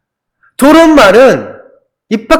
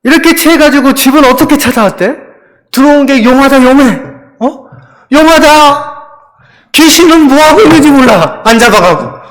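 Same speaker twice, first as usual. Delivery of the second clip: high, loud sarcastic scolding; nagging wife; 6 semitones higher, 4 dB louder than usual. Acted. Wife to drunk husband who found his way home, high angry nagging. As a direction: rail at the drunk husband, pitched up. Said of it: "아내가 이렇게 합니다".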